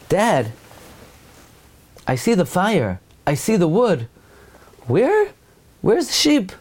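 put-on a boy growing suspicious; lightly voiced worried son; Yitzchak the son. Yitzchak asks his father.

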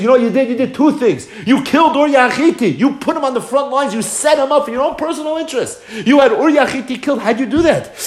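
high, insistent storyteller's rebuke; impassioned accusation. Acted. Prophet confronting the king over Uriah, accusing. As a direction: build the accusation, voice pitched high.

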